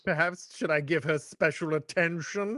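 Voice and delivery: lightly voiced taunting villain; mocking villain. Co-host imitating Fade making fun of Paul, mock taunting. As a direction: imitate a sly taunt, only lightly put on. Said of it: "Fade makes fun of Paul".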